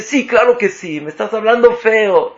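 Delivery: high-pitched acted complaint; offended insistence. Offended partner answers the denial, insistent protest.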